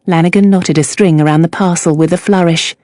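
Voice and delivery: ordinary delivery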